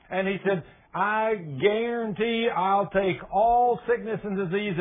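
fiery preacher at full volume; full-force preaching. Acted. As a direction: lift the church with a rising, booming sermon. Preaching God's promise.

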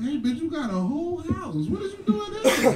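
exasperated quote. Retold or imitated. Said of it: "I'm like".

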